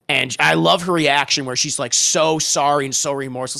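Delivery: normal talking voice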